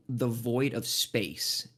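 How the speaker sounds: slow, hushed awe on the void of space; mysterious slow. Acted. GM describing a stranger's eyes, slow and awed. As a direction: draw out the words like revealing a wonder.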